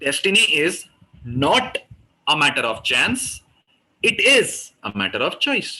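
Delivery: not lifted alone, it is punched; stress on not and it is. Shown as a demonstration. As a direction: hit not, then it is. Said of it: "इस बार था not पे और it is पे". (not और it is पर ज़ोर)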